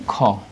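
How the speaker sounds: "খ" as a drawn-out "kho"; aspirated sound demo. Shown as a demonstration. Teacher isolating the K sound, stretched aspirated "খ". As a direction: release "খ" with a puff of breath. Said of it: "এই সাউন্ডগুলো থাকে".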